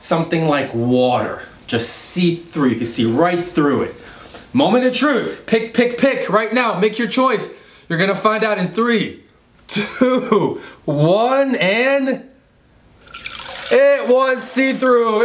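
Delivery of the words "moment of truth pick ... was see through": higher, excited countdown reveal; suspenseful build-up. Real, not acted.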